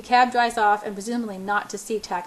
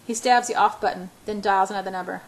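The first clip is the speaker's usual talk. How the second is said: usual speaking voice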